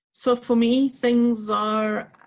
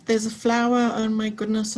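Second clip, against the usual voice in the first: usual speaking voice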